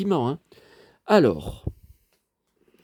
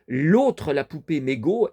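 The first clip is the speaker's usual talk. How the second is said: ordinary delivery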